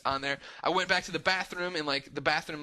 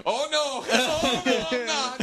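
high, louder, laughing no; laughing denial; 10 semitones higher, 6 dB louder, much slower than usual. Retold or imitated. Telling Katie the place is awful, laughing no. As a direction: crack up admitting the truth. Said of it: "I'm like".

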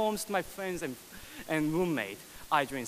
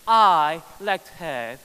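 climactic refrain; 10 dB louder than usual. Acted.

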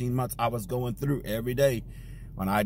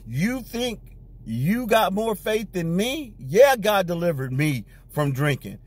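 retold angry retort; 6 semitones higher than usual. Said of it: "I got angry".

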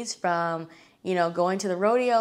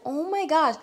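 excited gasp; 8 semitones higher than usual. Retold or imitated. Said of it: "I was so excited".

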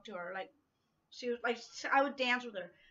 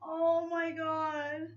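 tearful; 6 semitones higher, 4 dB louder, much slower than usual. Real, not acted. Emotional over gift.

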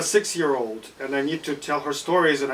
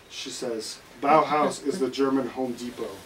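normal talking voice

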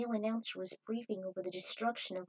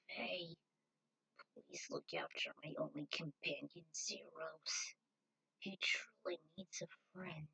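much softer, fading whisper; dying last words; 6 semitones lower, 9 dB quieter than usual. Acted. Dying Victoria says goodbye to Faye, whispered. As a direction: let the breath run out as you speak.

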